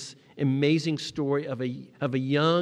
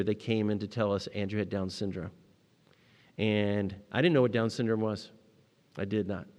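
ordinary talk

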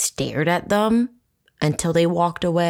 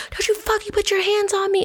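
high-pitched retold fury without much extra volume; indignant high mock yell; re-enacted losing it. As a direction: act out an outraged warning way up high. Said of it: "I, like, lost my shit".

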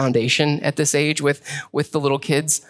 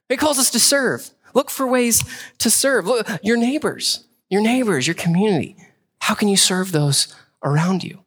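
fervent preaching; 6 semitones higher than usual. Acted. Preaching at full force.

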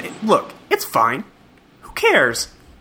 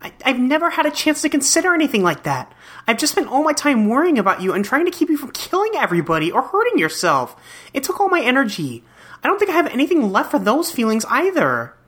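put-on higher, sweet troll girl voice; bubbly fish troll girl; 10 semitones higher than usual. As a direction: gently let him down.

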